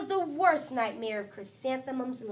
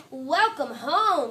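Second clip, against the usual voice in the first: warm welcome; 2 semitones higher, 7 dB louder, slower than usual. Acted. Mother greets daughter in story.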